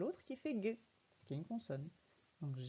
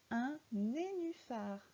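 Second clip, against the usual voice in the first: slow word reading; 3 semitones higher, slower than usual. Shown as a demonstration. Teacher reads word for child.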